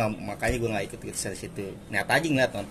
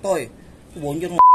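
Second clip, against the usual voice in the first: moderately raised, higher calling-out; retold yell; 5 semitones higher, 4 dB louder than usual. Friend spots Bonjer at Jati Baru, retold yell. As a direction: imitate a friend's warning yell, only partly raised. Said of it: "dia teriak".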